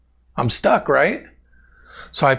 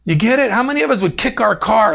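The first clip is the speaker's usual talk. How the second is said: usual speaking voice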